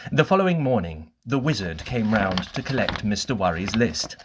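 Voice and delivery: plain reading voice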